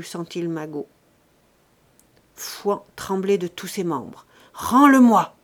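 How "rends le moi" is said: loud shouted order; furious demand. A narrator's reading. Trembling old father ordering his son to return money, furious. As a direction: explode on the words.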